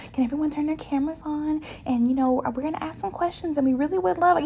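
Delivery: high, fast, sugary corporate request; high quick fake-friendly meeting host; mock manager voice. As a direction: parody a chirpy boss asking for cameras on. Mocking managers on conference calls.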